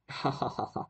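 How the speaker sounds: staccato acted ha-ha-ha; shepherd's mock laugh. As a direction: act out the prankster shepherd laughing. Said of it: "then he started laughing".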